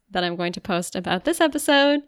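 ordinary talk, nothing marked

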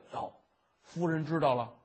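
ordinary delivery

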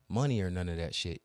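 ordinary talk

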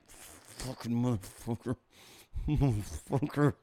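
low, slow muttered cursing; soft, suppressed rage; seething. Acted. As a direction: imitate fury held under the breath. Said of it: "Oh, he was seething pissed".

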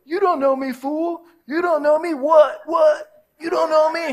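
high, loud street taunt; hammed-up street beef voice; street tough challenge. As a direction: get in his face like a neighborhood tough. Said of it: "they'll go".

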